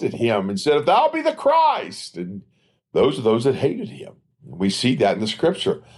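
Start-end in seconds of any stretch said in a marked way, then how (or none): 0.8-2.2 much higher and slow, sneering imitation; preacher mimicking the scoffers with a high, slow sneer; mocking scoff. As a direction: imitate the mob jeering him, dragging it out. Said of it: "mocked and made fun of him and scoffed".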